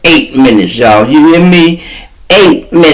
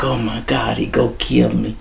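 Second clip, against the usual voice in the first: mock scared; 3 semitones lower, 7 dB quieter than usual. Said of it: "She is scared to death".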